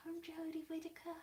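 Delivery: high, soft, Australian-tinged attempt; failed impression drifting into Australian; accidental Australian accent. Vlogger attempting the actress's accent, landing on soft Australian. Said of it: "That's more Australian".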